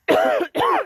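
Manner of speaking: sharp real cough from a swallowed bug; hard cough. Real, not acted. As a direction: cough hard to clear the throat. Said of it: "just swallowed a fly".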